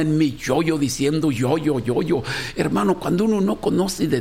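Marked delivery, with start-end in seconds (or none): none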